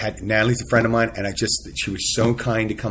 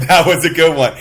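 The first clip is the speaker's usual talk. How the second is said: laughing Irish guy; 6 semitones higher, 10 dB louder than usual. Retold or imitated. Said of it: "he said".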